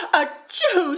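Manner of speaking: queen sneezes at the feathers; sneezing queen. A narrator's reading. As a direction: sneeze it playfully. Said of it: "sneezed the queen".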